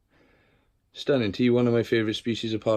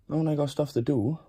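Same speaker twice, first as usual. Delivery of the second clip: ordinary talk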